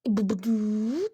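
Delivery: loud drawn-out boops rising at the end; imitated electronic boops. Storyteller switching the sound on, loud imitated boops. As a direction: sing out toy-like startup boops.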